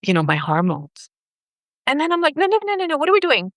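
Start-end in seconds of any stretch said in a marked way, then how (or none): 2.4-3.5 high, rapid string of no's; retold self-correction. Stopping her own doubt after the call, high alarmed protest. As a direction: catch yourself with high, hurried protest.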